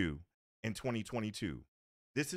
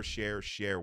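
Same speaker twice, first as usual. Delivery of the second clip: usual speaking voice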